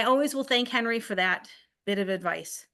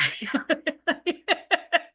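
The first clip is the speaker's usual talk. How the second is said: bright burst of laughter, pitched up; laughing; 8 semitones higher than usual. Real, not acted. She laughs, telling how her brothers tease her. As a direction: laugh at the nickname.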